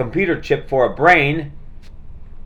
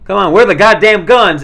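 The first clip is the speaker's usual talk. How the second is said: louder, higher angry yell at the machine; angry movie quote; 7 semitones higher, 9 dB louder than usual. Retold or imitated. Retelling a movie guy cussing at an ATM, mock angry yell. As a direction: bark it like a frustrated cop. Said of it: "And when he goes".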